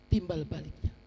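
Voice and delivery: ordinary talk, nothing marked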